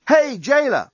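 high-pitched yell through prison bars; high calling shout. A narrator's reading. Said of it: "was yelling out of the bars".

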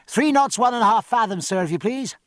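high, carrying report, easing on the courtesy; rather loud. A narrator's reading. Midshipman reports speed to Davidge for captain's ears, rather loud. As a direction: project it aft for the captain to overhear. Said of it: "directing his voice aft and speaking rather loud".

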